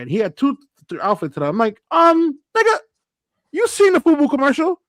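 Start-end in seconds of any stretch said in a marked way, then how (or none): 1.9-4.8 high, loud mock disbelief; exasperated retold scolding. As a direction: scold a friend for missing the obvious. Acts out scolding his friend's question.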